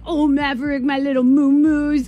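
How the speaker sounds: put-on high, slow baby talk to a beloved dog; doting dog voice. Host cooing to her dog Maverick in high baby talk. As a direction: coo at the dog like it's a baby. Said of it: "I don't even sound human".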